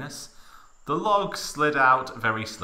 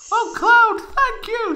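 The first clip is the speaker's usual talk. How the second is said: high falsetto, relieved and thankful; grateful Tifa voice; 10 dB louder than usual. Acted. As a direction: thank him in a high, breathless girl voice.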